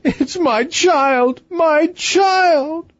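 very high, slow wail; drawn-out melodramatic lament; sobbing queen. A narrator's reading. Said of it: "sobbed Her Majesty".

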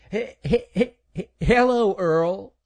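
put-on high boy's voice stuck on he- before hello; stuttering. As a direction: stammer nervously before hello. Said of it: "Stuttering Stan".

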